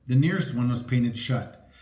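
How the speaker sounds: plain reading voice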